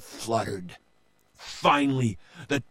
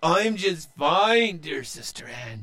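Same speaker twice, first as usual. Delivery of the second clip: grin as you answer; grinning Joe; 6 semitones higher than usual. A narrator's reading. Brother answering his sister with a grin. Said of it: "Joe said".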